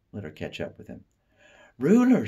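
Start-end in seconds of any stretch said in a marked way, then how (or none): 1.8-2.3 much higher, loud cry of his name; high gushing neighbour spotting the boy; excited lady calling. Acted. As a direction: call out to him in a high, thrilled lady voice. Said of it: "she cried".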